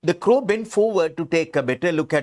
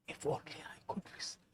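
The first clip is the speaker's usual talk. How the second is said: quick, voiceless whisper; sad whisper; 20 dB quieter, much faster than usual. Acted. Sad fox longing to hear the crow, whispered. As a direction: let it out on breath alone. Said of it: "He is really sad".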